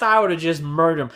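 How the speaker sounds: usual speaking voice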